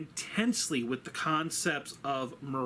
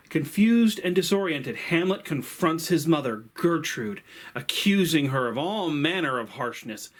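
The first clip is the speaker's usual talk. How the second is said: louder, driven storytelling voice; story narration; 7 dB louder than usual. A narrator's reading. Host retelling Hamlet's plot to viewers, louder dramatic narration. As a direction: tell the plot like a gripping tale, louder.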